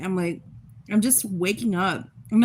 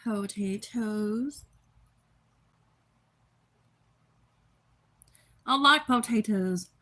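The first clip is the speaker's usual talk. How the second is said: slow and drawn out; much slower than usual. Real, not acted. Savoring potatoes, drawn out.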